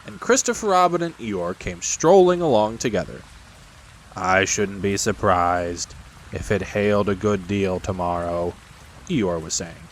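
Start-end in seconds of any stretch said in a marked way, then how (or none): 4.1-8.5 low, slow, gloomy donkey. Acted. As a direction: drone it slowly in a low monotone.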